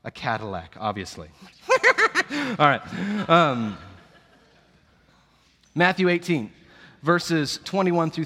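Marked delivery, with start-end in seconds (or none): 1.7-2.5 a sudden high laugh at his own joke; burst of laughter. Real, not acted. Pastor laughing at his own Cadillac joke, high burst. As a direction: let a real laugh burst out, high and loud.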